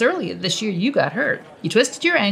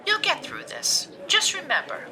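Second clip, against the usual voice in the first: Karen's voice; 5 dB quieter than usual. Acted. Karen voiced, higher, slow.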